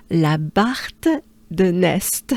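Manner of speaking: La Barthe de Nest said slowly in French; French place name. Shown as a demonstration. Teacher introducing the town, slow French La Barthe de Nest. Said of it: "they will arrive in".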